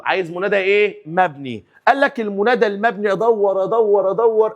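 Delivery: ordinary delivery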